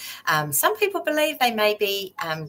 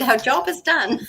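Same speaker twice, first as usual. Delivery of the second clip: laughing; 5 semitones higher, 3 dB louder than usual. Real, not acted. Joking sign-off, laughing.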